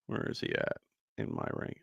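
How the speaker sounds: whispered, half to himself while searching; muttering to himself. Real, not acted. Streamer mutters to himself searching his rankings, whispered. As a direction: breathe it out under the breath, checking a list.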